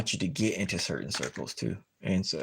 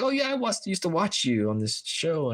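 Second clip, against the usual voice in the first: high, eager, sudden lift in pitch; mock starstruck voice; excited recognition; 8 semitones higher than usual. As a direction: gush a bit at spotting a star. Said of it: "I was like".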